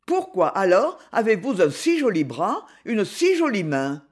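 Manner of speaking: plain reading voice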